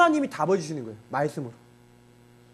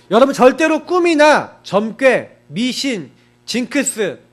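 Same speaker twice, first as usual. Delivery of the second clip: loud, slow warning; preaching warning; 3 semitones higher, 6 dB louder, much slower than usual. Acted. Pastor warning the congregation against superstition, loud and slow. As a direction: hammer the warning home.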